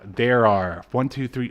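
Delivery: ordinary talk, nothing marked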